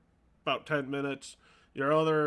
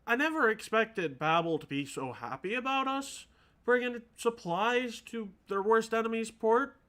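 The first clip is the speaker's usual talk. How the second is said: put-on higher-pitched game character, chatting to an old acquaintance; higher, lilting character voice; Yuri; 6 semitones higher than usual. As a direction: lift the voice for the character. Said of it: "Yuri walks up and she's like".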